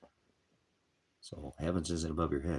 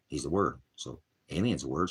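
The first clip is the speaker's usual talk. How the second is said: ordinary talk, nothing marked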